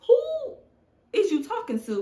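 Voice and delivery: high, loud mock comeback; imitated indignant husband. Husband answering back to his wife's rudeness, mock indignant. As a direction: throw back an exaggerated, high-pitched challenge. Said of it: "because then he's gonna tell me".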